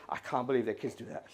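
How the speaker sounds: low, hushed disapproval; judgmental aside. Acted. Parent to spouse on the way home, hushed judgment.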